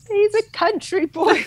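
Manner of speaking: light mimic of proud mum; mother-in-law. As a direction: quote her fondly. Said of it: "his mum always goes".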